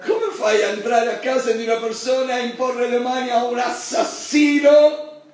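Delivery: preacher crying out in astonishment; incredulous shout. Acted. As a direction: rise into outraged disbelief. Mock incredulous outburst.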